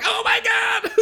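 very high mock squeal; excited little girls. Friends seeing the new clubhouse, hammed-up excited squeal. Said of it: "a bunch of excited little girls".

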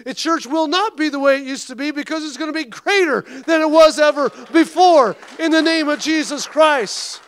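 loud, high, fervent sermon delivery; fiery preaching. Real, not acted. Pastor preaching hard to his church, fervent and loud.

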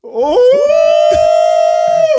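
excited shout. Real, not acted. Hype reaction.

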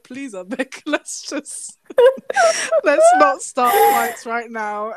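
pleading through giggles; half-laughing please to her friend Salma. Retold or imitated. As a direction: beg her to stop, laughing. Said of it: "I'm just thinking".

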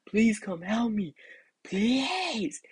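high, drawn-out mock wail for help; retold begging scream. Kid begging mom for help, high mock wail. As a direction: plead in a long high whine. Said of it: "I was screaming all this in Spanish".

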